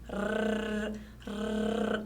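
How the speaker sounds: soft, sustained tongue trill "Rr"; trembling R. Shown as a demonstration. Teacher letting pupils hear how it trembles, soft trill. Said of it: "ele treme".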